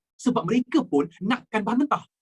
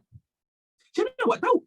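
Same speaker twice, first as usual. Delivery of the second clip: mock surprise. Real, not acted. Students all got it right.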